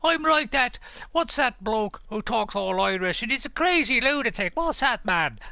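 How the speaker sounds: loud, high-pitched mock Irish lilt; hammed-up Irish accent. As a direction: put on an over-the-top, excitable Irish accent. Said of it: "You sound Irish".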